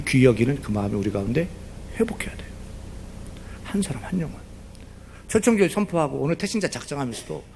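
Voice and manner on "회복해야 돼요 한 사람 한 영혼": softly whispered urging; sermon whisper. Acted. Pastor whispering the plea to the congregation. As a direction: hush it to the congregation.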